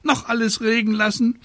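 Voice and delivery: very high, scolding bird voice; screeching raven. A narrator's reading. Raven scolding the witch over her rain, screeching. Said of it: "kreischte Abraxas".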